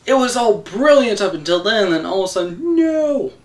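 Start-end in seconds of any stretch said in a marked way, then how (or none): 2.8-3.3 mock Vader scream. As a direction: ham up Vader's wail to mock it. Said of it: "Good God, man".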